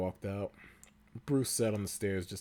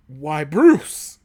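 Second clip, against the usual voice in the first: angry; 7 semitones higher, 12 dB louder, slower than usual. Acted. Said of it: "Dick said angrily".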